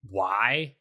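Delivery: ordinary delivery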